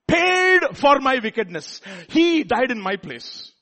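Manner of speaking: ringing declaration to the congregation; impassioned proclamation. Real, not acted. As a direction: proclaim each phrase forcefully, stretched out. Preacher climaxes quoted hymn lines.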